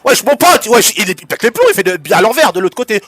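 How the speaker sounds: loud, high stunned yelling; excited disbelief. Real, not acted. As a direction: yell in total disbelief. Co-caster reacts to wild play.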